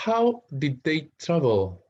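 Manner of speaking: slow teacher read-aloud; slow question read. Shown as a demonstration. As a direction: read it slowly and clearly for the class. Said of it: "teacher reading exercise question".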